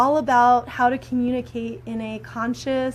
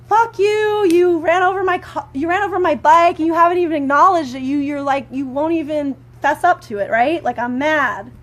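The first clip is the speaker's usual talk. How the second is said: loud, higher angry rant at the driver; angry outburst; 4 semitones higher, 6 dB louder than usual. Shown as a demonstration. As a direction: snap it out like you're furious the bike got crushed. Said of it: "the normal way that I would communicate, if I was angry".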